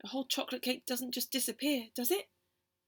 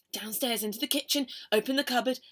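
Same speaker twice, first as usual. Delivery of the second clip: usual speaking voice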